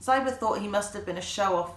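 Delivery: ordinary delivery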